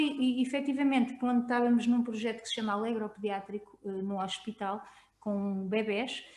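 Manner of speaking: ordinary talk, nothing marked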